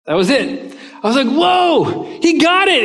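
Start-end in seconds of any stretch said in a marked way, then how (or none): 1.3-2.8 high, loud, starstruck outburst; amazed excitement. Retold or imitated. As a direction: burst out in awe, gradually coming down. Amazed that Falwell replied.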